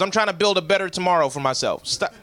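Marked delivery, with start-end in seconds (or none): none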